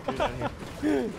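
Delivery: surprised exclamation. Real, not acted. Surprised to find teammate below.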